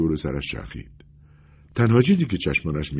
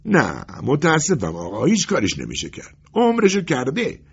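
emphatic, final no; firm refusal; 7 semitones higher than usual. A narrator's reading. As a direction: shut the request down, stretching the key words. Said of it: "پتروویچ با قاطعیت جواب داد".

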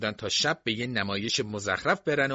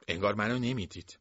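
usual speaking voice

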